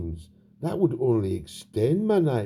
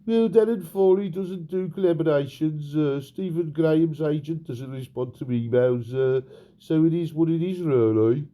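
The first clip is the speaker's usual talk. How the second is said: a mock manager voice done in a Birmingham accent instead of a Scouse one; Steven Gerrard in Brum accent; 2 semitones higher than usual. Mock Gerrard justifies his voice to the interviewer, Brummie accent. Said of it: "me Brum accent".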